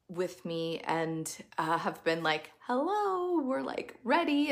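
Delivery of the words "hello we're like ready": much higher squeaky little voice; eager ideas voice. Pretend. Host voicing her ideas begging to start, squeaky and eager. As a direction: play the ideas as tiny eager beings. Ideas personified calling for attention.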